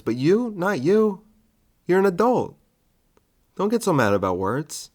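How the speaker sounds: high, sing-song condescension; talking down. Acted. Comic to angry listener, patronizing sing-song. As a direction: coax them like a child.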